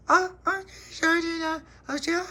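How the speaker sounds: put-on very high, babyish flustered squeak; startled toddler. Baby Lucia suddenly hearing something, very high toddler voice. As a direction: flutter like a scared little one.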